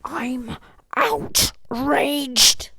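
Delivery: very slow, loud, drawn-out outrage; mock outrage. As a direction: stretch it out like a hammed-up complaint.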